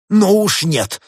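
high, loud refusal; outraged rabbit doctor; indignant protest. A narrator's reading. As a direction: object hotly at full voice. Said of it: "возмутился кролик".

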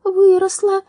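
high, short metallic reply; curt robot answer. A narrator's reading. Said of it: "коротко пояснил Дром".

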